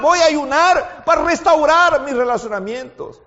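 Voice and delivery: loud, high, fervent sermon cry; preaching at full force. Acted. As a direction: pour everything into the plea. Preacher's climax into prayer.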